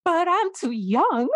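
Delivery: high, pleading mock protest, retold; protesting to mom. Retelling the call to her mom, a high pleading protest. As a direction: squeak it out like a distraught younger self.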